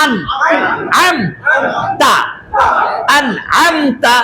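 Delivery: আন, আম, তা chanted syllable by syllable; syllables called out to the class; spelling out syllables. Shown as a demonstration. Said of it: "আন হবে, আং না কিন্তু".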